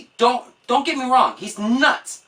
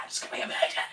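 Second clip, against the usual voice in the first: mock whisper; 10 dB quieter, much faster than usual. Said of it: "And you're like".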